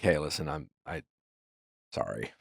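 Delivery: very low, soft, halting voice; imitating a sheepish sorry, dropping almost to nothing; cooled-down apology. Partner apologizing half a day later, low halting mumble. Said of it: "they cooled out".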